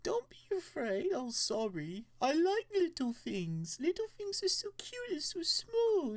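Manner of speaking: put-on very high baby-talk voice; doting, gushing high coo; cooing reassurance. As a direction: soothe a frightened tiny pet in falsetto. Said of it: "Toby seems to be in quite little fear".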